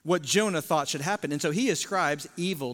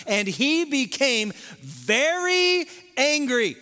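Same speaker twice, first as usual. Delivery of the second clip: raised, slow, emphatic preaching; preaching at full force; 5 semitones higher, slower than usual. Acted. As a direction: build the anger of the text, slowly and higher.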